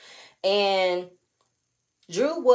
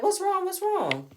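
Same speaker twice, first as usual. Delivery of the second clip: much higher, caring asking; concerned sister; 12 semitones higher, slower than usual. Retold or imitated. Sister asking Drew what happened, high concerned voice. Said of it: "she's like".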